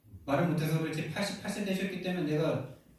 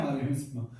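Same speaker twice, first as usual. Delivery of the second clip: normal talking voice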